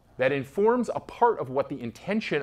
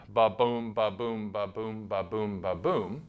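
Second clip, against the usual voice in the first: each "ba-boom" weak then strong, fading softer; iambic beat; 4 semitones lower, 4 dB quieter, slower than usual. Shown as a demonstration. Teacher demonstrating the iambic pulse, softly chanted. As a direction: tap out the heartbeat rhythm. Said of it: "this rhythmic pattern".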